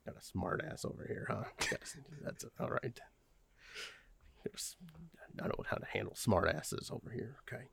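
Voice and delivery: whispered under the breath; whispered aside. Acted. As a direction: mutter it so the student can't hear. Muttered aside about student.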